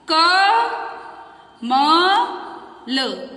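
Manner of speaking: slow syllables with long gaps; sounding out slowly. Shown as a demonstration. Teacher dictating the word in pieces, slowly. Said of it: "फिर टुकड़ों में लिखेंगे तब।".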